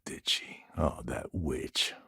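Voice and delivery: slow, bitter whisper under the breath; hushed whisper. Acted. Pirate muttering to the agent about a rival, slow whisper. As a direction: hiss it to yourself, slow. Spiteful aside about her.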